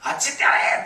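loud imitation of an angry heckler; angry yell. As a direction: bellow it as if chasing someone off. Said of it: "大きな声で怒鳴られたんですって".

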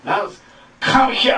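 high, loud come here; shouted command. Retold or imitated. Quoting the original words as a loud shout. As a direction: shout the summons. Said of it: "言語ではね".

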